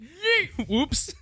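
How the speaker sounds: high squealed yeet, tossing him away; a sudden high playful yell; gleeful yeet. Pretend. Recliner flinging a victim, gleeful high yelp. Mock recliner throwing a guy.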